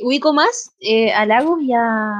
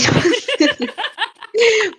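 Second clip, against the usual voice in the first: high burst of laughter; 11 semitones higher than usual. Real, not acted. Laughing at hard choice.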